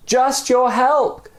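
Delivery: high, slow request; simple plea. Acted. Stranger answering from below, high slow plea. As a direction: ask plainly, drawn out. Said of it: "came the reply".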